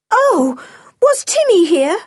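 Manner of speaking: put-on high, excited child's cry; joyful cry. A narrator's reading. George hearing news of the dog, crying joyfully. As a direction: burst out with sudden high joy. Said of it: "cried George joyfully".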